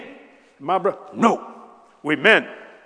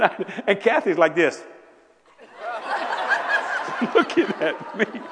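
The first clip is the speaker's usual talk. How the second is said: ordinary talk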